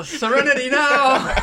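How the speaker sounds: loud, slow, laughing catchphrase yell; mock yell. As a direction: shout it out through laughter, slow. Quoting a sitcom catchphrase.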